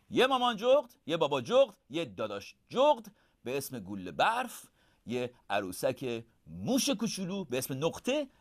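ordinary delivery, nothing marked

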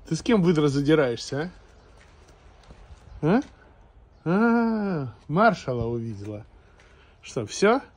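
loud teasing scold; talking to a dog. Real, not acted. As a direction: raise voice at the scrappy dog. Scolding otter-like dog loudly.

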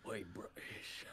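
a quick whispered mock cockney "Oi, British"; hushed, playful cockney accent. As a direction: throw out a jokey London cockney. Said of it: "There go full cockney".

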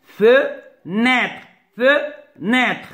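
"Fenêtre" broken as "Fe", pause, "nêtre"; two separate beats in each "Fenêtre"; split into syllables. Shown as a demonstration. Teacher cutting the word up for pupils, two clear beats. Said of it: "se compose de deux syllabes".

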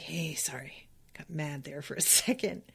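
hushed, low, near-whispered apology; sheepish apology. Acted. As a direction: apologise under your breath, a bit sheepish. Dog sheepishly apologises after rage.

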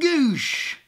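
loud, drawn-out splat noise; imitated bat smash. Toy Negan bashes the zombie's brains, loud smash sound. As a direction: voice the bat hitting with a big goosh.

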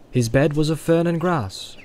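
plain reading voice

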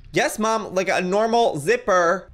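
loud, high impatient reply; exasperated daughter. Acted. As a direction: snap back at Mom, exasperated. Exasperated daughter answers Mom.

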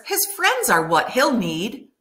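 usual speaking voice

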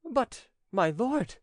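high, slow hesitant objection; astounded stammer. A narrator's reading. Servant objecting to his master's plot, astounded. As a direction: falter in high-pitched astonishment. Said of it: "stammered Trespolo, astounded".

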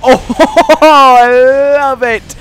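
loud, high, drawn-out delight; real gleeful cry, stretched long; thrilled outburst. Real, not acted. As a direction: whoop it out with huge excitement. Thrilled by the move in game.

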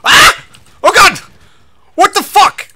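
real high-pitched panicked shrieking; loud, high yelps of alarm; startled scream. Real, not acted. Startled by attack in game.

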